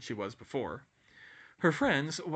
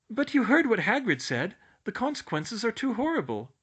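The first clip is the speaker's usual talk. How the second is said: high, fretful objection; worried schoolgirl voice; 11 semitones higher, 6 dB louder than usual. A narrator's reading. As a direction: warn him off anxiously. Hermione objecting.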